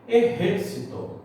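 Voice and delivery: "Ejército" as "eh-HER-si-to"; stress on antepenultimate syllable. Shown as a demonstration. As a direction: hit the second syllable of "Ejército", Spanish-style. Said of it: "antepenúltima".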